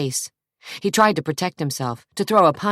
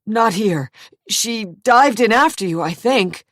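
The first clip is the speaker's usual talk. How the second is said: higher, louder nervous answer; nervous evasion; 7 semitones higher, 5 dB louder than usual. A narrator's reading. Jason to his angry brother, a high nervous dodge. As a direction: dodge the question, rattled.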